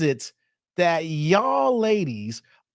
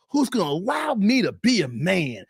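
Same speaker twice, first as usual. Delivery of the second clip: usual speaking voice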